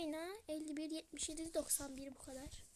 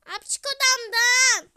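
higher, much louder shout; angry yell; 8 semitones higher, 26 dB louder than usual. Real, not acted. To her brother barging into the room, furious yell. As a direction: scream at him to get out.

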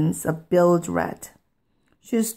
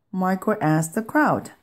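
ordinary delivery

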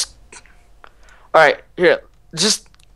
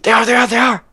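high, frantic warning; panicking gamer; 7 semitones higher than usual. Real, not acted. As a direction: call it out in panic. Enemies rushing in.

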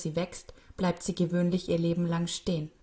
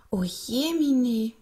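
high, slow, drawn-out oh dear; loud lilting childlike dismay; dismayed boy; 6 semitones higher, 6 dB louder, much slower than usual. A narrator's reading. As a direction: wail it in shocked sympathy. Boy discovers flower in bin.

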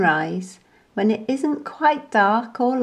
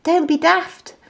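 high, amused put-down; cheeky monkey teasing; scoffing monkey; 7 semitones higher than usual. A narrator's reading. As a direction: laugh off his fear. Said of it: "laughed and said".

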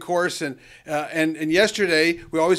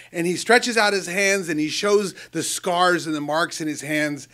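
ordinary talk

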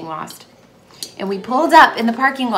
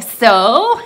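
expectant friends; 10 dB louder than usual. Retold or imitated. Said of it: "And they're like".

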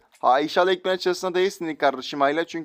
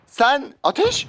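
high, drawn-out call; urgent call; 9 semitones higher, much slower than usual. Real, not acted. Urging a teammate to fire, drawn out high. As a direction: stretch the word out high.

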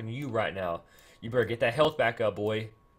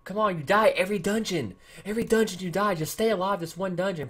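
usual speaking voice

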